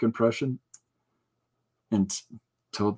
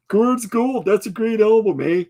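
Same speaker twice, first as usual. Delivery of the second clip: Canadian hoser voice; 10 semitones higher, 4 dB louder than usual. Shown as a demonstration. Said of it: "finish that sentence with E H".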